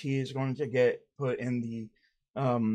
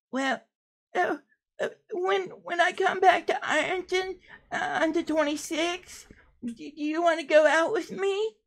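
much higher, hopeful put-on voice with a teary laugh; Sweetie character voice. Sweetie asking the interviewer on a date, high and hopeful. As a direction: ask shyly in the silly voice.

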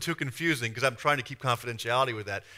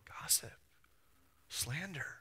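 soft, hushed rebuke; quiet inner voice naming the sin; whispered warning; 2 semitones higher, 12 dB quieter, slower than usual. Pretend. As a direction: whisper it like a conscience. Holy Spirit's quiet warning.

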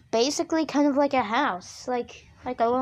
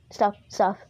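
normal talking voice

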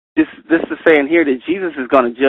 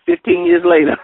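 ordinary talk

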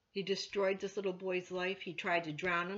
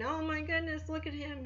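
higher mock cheer of amazed onlookers; imagined crowd cheering; 8 semitones higher than usual. The crowd seeing the healed boy, light mock cheer. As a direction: voice the crowd's delighted surprise, lightly. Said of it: "everybody had to be cheering and saying".

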